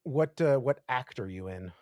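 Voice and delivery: ordinary talk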